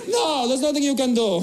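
hint of a helpless shrug; lightly voiced unhelpful local. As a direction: deliver useless advice, lightly in character. [name] repeats unhelpful answer.

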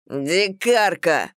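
drawn-out slow shout; shouted insult. Acted. Husband shouts back at his fleeing wife, slow drawn-out shout. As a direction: hurl the word back slowly. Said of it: "закричал в ответ".

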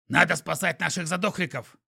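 squeaky creature in a panic; urgent panting report. A narrator's reading. Skunk voiced, panicked.

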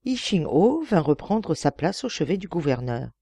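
usual speaking voice

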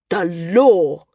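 the senior flyer demanding the ruling, insistent; insisting. A narrator's reading. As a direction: cut him off and insist. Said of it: "Corm insisted".

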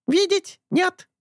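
high slow squawking bird. A narrator's reading. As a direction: parrot denial. Bird denies. (птичий крик)

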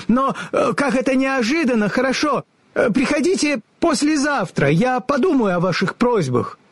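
high, hesitant stalling; flustered wizard. A narrator's reading. The wizard stalls the travellers, high and nervous.

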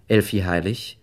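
ordinary talk

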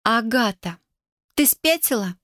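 high, hammy stage cry of shock; theatrical exclamation. Acted. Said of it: "театрально воскликнула".

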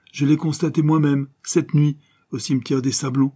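high, loud, forceful assertion; emphatic insistence. A narrator's reading. Adventuress assuring the detective she saw it herself, emphatic insistence.

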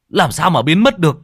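loud, high, frantic demand; frantic shout. A narrator's reading. Toàn frantically yells at his wife how the child vanished. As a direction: shout it like a father losing his mind. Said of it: "Cậu Toàn như phát điên".

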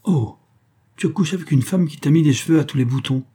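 mild acted cry of outrage; indignant cry. A narrator's reading. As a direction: exclaim it in shocked accusation. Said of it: "elle s'écria".

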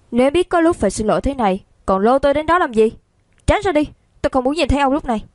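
fast, high-pitched cross scolding; irritated, rapid-fire rebuke; angry. A narrator's reading. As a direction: push them away in a quick huff. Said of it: "ôm lấy người đang tức giận kia".

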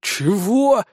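high, drawn-out indignation; indignant creature. A narrator's reading. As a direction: object slowly in a high creature voice. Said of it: "возмутилось существо". (возмущённое существо)

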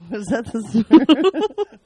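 slow, loud laughing question. Real, not acted. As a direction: laugh through the words. Surprised, laughing at own humming.

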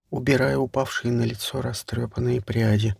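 plain reading voice

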